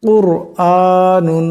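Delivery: কুরআনুন very slowly, র heavy and rounded. Shown as a demonstration. Teacher demonstrating কুরআনুন slowly with a heavy র. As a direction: draw out কুরআনুন with a thick র. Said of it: "এই রাকে মোটা আওয়াজে পড়তে হবে".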